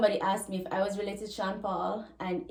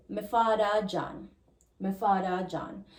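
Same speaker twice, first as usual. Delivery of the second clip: Jamaican patois, "father" as "fada". Shown as a demonstration. Teacher models naming the father, in Jamaican patois. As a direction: answer in Jamaican patois, falling at the end. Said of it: "in Jamaican patois".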